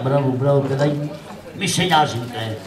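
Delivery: very low, kindly authority; reassuring Pasha. Acted. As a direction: console the boy in a deep official voice. Pasha reassures the orphaned boy. (βαθιά, καθησυχαστική φωνή Πασά)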